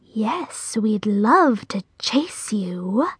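slow, whispery spider voice; eerie, drawn-out relish; menacing spider. A narrator's reading. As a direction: savour the threat in a soft whisper. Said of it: "said another".

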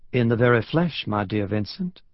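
put-on refined Southern accent; courtly American South accent; cultured Southern drawl. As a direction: answer an old friend in a genteel Southern drawl. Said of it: "replaced by the cultured Southern drawl".

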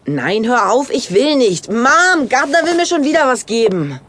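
high, rising complaint calling out to Mom; whiny call for mom. Acted. Kid calling mom about brother.

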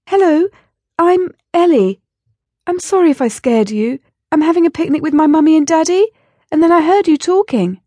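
put-on high, bright child voice, a bit fast; a small girl greeting a rabbit politely; little girl Ellie. A narrator's reading. Ellie apologising to the startled rabbits, high child voice.

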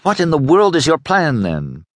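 exasperated demand, starting high and falling away; mildly fierce, frustrated challenge; fierce frustration. A narrator's reading. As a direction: demand an answer, pitch leaping up then dropping. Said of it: "fierce in her frustration".

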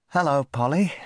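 high, coaxing hello; boy gently greeting a parrot. Acted. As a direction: coax the parrot to talk. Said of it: "he said to the parrot".

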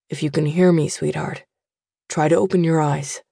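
lightly voiced father urging his daughter to open her eyes; father's voice. A narrator's reading. Father urges daughter to wake.

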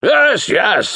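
high, loud, indulgent wizard; indulgent boom. A narrator's reading. Wizard humouring Bingo, indulgent boom. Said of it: "boomed the wizard indulgently".

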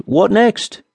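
high-pitched eager question; eager miller. A narrator's reading. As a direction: ask with pitch flung high. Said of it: "and then asked".

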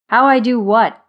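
loud, grumpy grasshopper; monotone question. A narrator's reading. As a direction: answer loudly, unimpressed by the fuss. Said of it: "came Zimmer's monotone voice".

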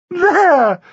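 high, crowing exclamation; triumphant. A narrator's reading. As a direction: crow it in triumph. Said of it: "said Flossie in triumph".

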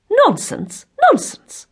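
high, loud, dismissive outburst; emphatic exclamation. A narrator's reading. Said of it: "that emphatic exclamation".

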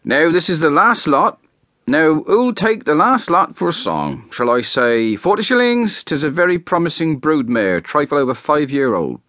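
put-on country auctioneer pitching an old mare; auctioneer calling out. A narrator's reading. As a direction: sell the last lot to the crowd. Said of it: "could be heard saying".

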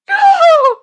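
very high, loud scream; anguished yell. Acted. Condemned woman hearing her sentence, screaming yell. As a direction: shriek your refusal in one long note. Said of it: "yells the woman".

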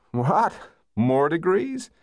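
high, rising disbelief; incredulous. A narrator's reading. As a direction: question it in astonishment. Boyfriend incredulous at more study.

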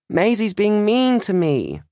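high, drawn-out tattling yell; slow, high-pitched complaint in a small girl's voice; yelling child. A narrator's reading. Littlest sister telling on Maisie from another room, yelling. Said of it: "Isla, yells from the living room".

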